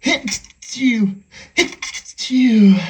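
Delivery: loud double achoo; explosive sneezes after a build-up. Acted. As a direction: let two big sneezes burst out. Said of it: "I feel one coming".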